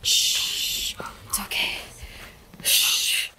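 hushed, breathy shushing; soothing whisper. Real, not acted. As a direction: whisper gently to calm. Hushing the birthing dog.

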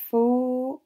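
"faux" étiré, fffooo, fort et plus haut; f initial allongé. Shown as a demonstration. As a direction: tenir longuement le f de "faux" avant la voyelle. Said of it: "on entend f au premier son". («faux» appuyé, f soufflé puis o prolongé)

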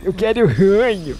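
Billy impression. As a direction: say it like a goofy cartoon boy. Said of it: "eu sou o Billy".

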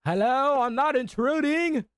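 high, loud singsong announcement; calling out. Real, not acted. Delivery driver announcing himself while entering, singsong call. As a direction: sing it out high into the house.

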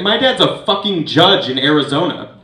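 loud indignant yell; a loud mock-indignant yell; retold screaming. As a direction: yell it at the cops. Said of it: "is screaming at these guys".

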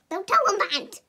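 high, loud shrieking goodbye; loud squeal. Real, not acted.

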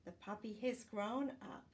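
ordinary delivery

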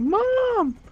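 put-on squeaky child shout; whiny kid. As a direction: play a hyper child calling his mom. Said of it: "He's like".

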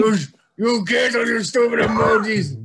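put-on loud, high old-man yell at the kids; hammed-up grumpy old man shouting; cranky old man. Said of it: "You sound like a crouchety old man".

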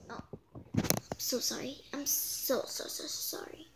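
breathy whispered sorry, trailing away; whispered apology. Real, not acted. Reader whispering sorry to the listening kids. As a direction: murmur the apology under your breath.

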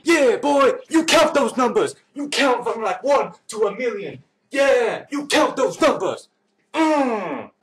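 very high put-on voice, words stretched; Marcos Zavala count. Counting vlogger doing a very high silly voice. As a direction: squeak it out like a cartoon kid. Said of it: "the way Marcos Zavala would count".

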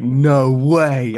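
loud, drawn-out astonishment; amazed disbelief. Retold or imitated. Makeup artist learns he is English, loudly amazed. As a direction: imitate someone utterly floored.

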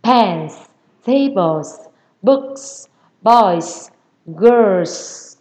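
word list with a long hissing S on each ending; strongly hissed final S. Shown as a demonstration. Teacher modelling plural endings for students, strong hissed S. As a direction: hiss out the last S of "Pens, tables, books, boys, girls". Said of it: "xì mạnh nó ra nha các bạn".

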